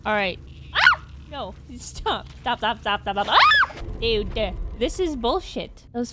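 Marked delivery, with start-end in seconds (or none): 0.7-1.0 short, sharp scream; startled yelp. Real, not acted. Startled by game. 3.3-3.7 very high, loud scream; panicked scream. Real, not acted.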